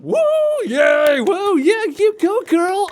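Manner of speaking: high, loud whooping cheer; sassy cheering lady. Retold or imitated. Host imitating a sassy lady cheering loudly. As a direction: whoop like a studio audience host. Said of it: "Krillin mixed up with Oprah".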